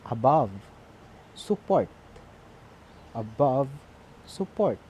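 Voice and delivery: a-BOVE, sup-PORT, each stressed on the second syllable. Shown as a demonstration. Teacher giving iamb examples, second-syllable stress on above and support. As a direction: hit the end of above and support, word by word. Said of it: "putting stress in the second syllable".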